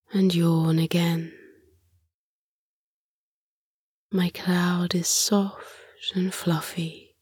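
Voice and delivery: hushed bedtime story whisper; whispered sleep story. Acted. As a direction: breathe it out gently like a lullaby.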